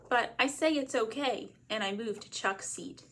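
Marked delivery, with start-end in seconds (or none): none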